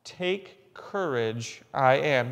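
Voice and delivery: soft and slow, drawing out "Take courage"; a preacher gently repeating the words of Jesus; tender, hushed. Shown as a demonstration. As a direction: say "Take courage, I am" slowly and softly, like comforting someone. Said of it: "That's all he said".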